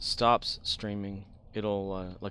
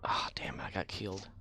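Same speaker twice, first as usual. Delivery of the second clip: under-the-breath damn; whispered curse; 2 semitones lower, 6 dB quieter than usual. Real, not acted. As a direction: breathe it out in frustration. Died in game, muttered.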